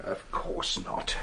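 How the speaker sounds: whispered, slow and very soft; detective whispering a quick denial at the crime scene. Acted. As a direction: hush it under your breath. Quick hushed reply at crime scene.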